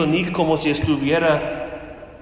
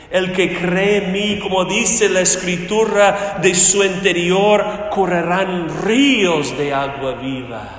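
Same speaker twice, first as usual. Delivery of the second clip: loud, ringing scripture quotation; preacher's raised voice, leaning on RÍOS; full-force preaching; 3 semitones higher, 5 dB louder than usual. Acted. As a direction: proclaim the verse with force.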